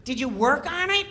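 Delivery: high, whiny heckle; mock Kattan jabbing at Norm; Kattan needling. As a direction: nag Norm in a high put-on whine. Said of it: "Kattan's going".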